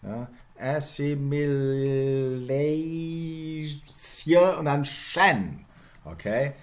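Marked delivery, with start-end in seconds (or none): 0.6-5.7 ASSIMILATION hugely slowed, "lay-sye", then "shen"; step-by-step sound change. Shown as a demonstration. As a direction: stretch Assimilation, then turn the ending into shen. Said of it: "That's the way it went".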